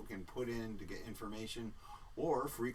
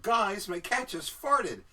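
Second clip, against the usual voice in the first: exaggerated chat read; 10 semitones higher, 9 dB louder, slower than usual. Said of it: "Zachariah wants everyone to know".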